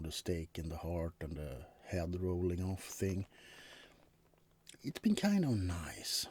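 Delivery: ordinary delivery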